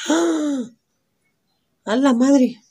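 high, loud shocked cry; real startled exclamation. Real, not acted. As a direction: gasp at the discovery. Finds a near-identical shade.

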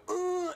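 straining grunt. Retold or imitated. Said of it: "I go".

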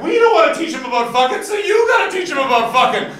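high, loud outraged yell; angry parent shout. Retold or imitated. Protesting parents yelling at the school board. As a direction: rant like a furious parent. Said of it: "the parents revolted, saying things like".

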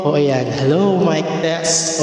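mic test drenched in echo; heavy echo. Shown as a demonstration. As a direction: stretch the mic check through heavy reverb. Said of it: "tataasan natin ng echo". (boses na may malakas na echo)

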